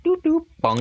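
very high little doops and a bonk; playful sound effects. Retold or imitated. Describing the morning shot routine, high playful sound effects. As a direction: voice cartoonish effects, high and light.

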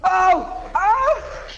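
high-pitched cry of alarm; sudden high "oh" of shock; startled yelp. Real, not acted. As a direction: yelp as if just struck. Hit by killer in game.

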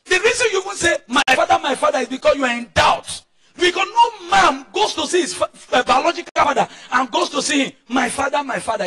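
high-pitched, worked-up sermon voice; full-force preaching. Real, not acted. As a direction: scold the congregation from the pulpit.

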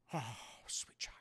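very soft, whispered affection; tender murmur. Acted. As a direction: whisper it fondly to the child. Old man receives a hug.

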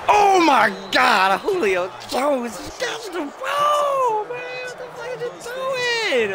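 real high-pitched gamer meltdown; exasperated yelling. Real, not acted. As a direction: holler at the player, dragging the words out. Yells at Julio's blunder.

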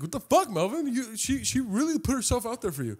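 high-pitched, softened scolding voice; mock scolding, high; indignant friend. Best friend scolding in story.

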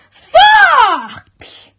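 loud, drawn-out cry on the word; dramatic reveal. A narrator's reading. Narrator revealing the sneaking intruder, sudden cry. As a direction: burst out with the shock reveal.

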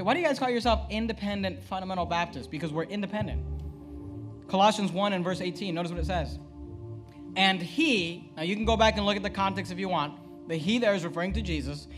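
normal talking voice

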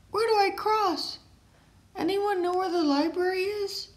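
put-on high childlike character voices; high excited kid voices in a story. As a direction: play the kids and sign kids eagerly.

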